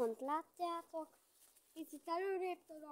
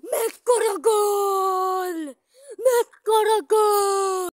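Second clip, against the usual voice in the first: loud, high, slow goal cry; excited sports commentary; 6 semitones higher, 21 dB louder, much slower than usual. Real, not acted. Goal celebration.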